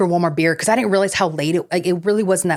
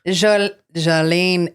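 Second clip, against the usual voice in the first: put-on Scottish accent; much slower than usual. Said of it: "his, like, Scottish accent".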